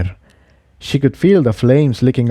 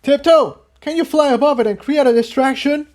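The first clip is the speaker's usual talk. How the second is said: urgent call. Acted. Jigsaw calling during fight.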